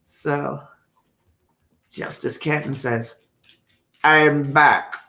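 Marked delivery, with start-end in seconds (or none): 4.0-5.0 loud, flat Terminator line; bad Arnold impression. Reading a viewer's comment aloud as a failed Arnold impression. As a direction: try for Arnold's clipped accent and miss. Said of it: "not a very good Arnold".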